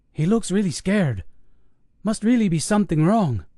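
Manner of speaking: a hint of unease, doubting; uneasy paramedic. Paramedic doubting his partner about the scared old man, uneasy. Gonzalez voiced, worried.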